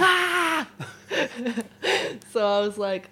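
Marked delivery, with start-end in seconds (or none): 0.0-0.7 high, loud playful scream; hammed-up squeal of joy; mock excited scream. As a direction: scream along in pretend celebration. Joins the pretend celebration.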